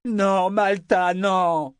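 put-on high, drawn-out drunken cry; slow, pleading bellow; desperate bellow. A narrator's reading. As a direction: wail it out slowly. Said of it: "beugla-t-il dans la nuit".

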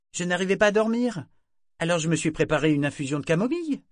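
quick innocent excuse; teen daughter voice. A narrator's reading. Daughter explaining her late tea to her mother.

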